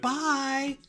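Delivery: very high, sing-song bye; a gushing farewell to a regular; enthusiastic goodbye. Retold or imitated. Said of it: "Like, really into it".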